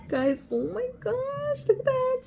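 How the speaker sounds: a real high-pitched thrill; excited squeal. Real, not acted. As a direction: call the viewers over in a high squeal. Spotting a detail on the shoe.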